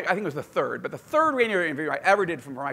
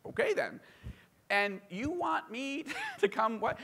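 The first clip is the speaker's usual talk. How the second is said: mock disbelief; 6 semitones higher, 6 dB quieter than usual. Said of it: "reacting to the minister".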